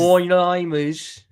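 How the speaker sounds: loud, drawn-out Brummie impression; Brummie accent. Mocking how Brummies talk to a co-host, Brummie accent. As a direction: stretch it out in a mock Brummie accent. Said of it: "that's literally how they talk".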